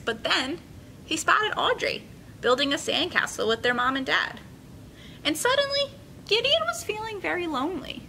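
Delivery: put-on bright, paced read-aloud for kids; story narration. A narrator's reading. Teacher reading a picture book to her class, lively and clear. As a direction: tell the story to a room of children.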